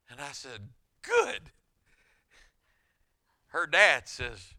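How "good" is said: high, loud burst of glee; eager shout. Retold or imitated. Pastor gleefully accepting the challenge, loud high shout. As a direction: bark it out, thrilled at the challenge.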